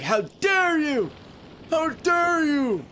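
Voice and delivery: very high, slow, drawn-out outraged cry; outraged dweeb kid. Retold or imitated. Imitating the dweeb kid who was screaming disgusting at him, very high and slow. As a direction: stretch each word way up like a squealing nerd. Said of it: "He sounded like".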